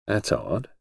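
soft, musing undertone; low voice, to himself. A narrator's reading. Husband musing on his wife's nerves, low voice. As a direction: murmur it as a private thought. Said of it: "in a low voice, as though speaking to himself".

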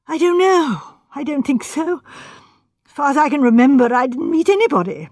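put-on anxious, overwrought young architect; nervous young man. Voices flustered Sandford.

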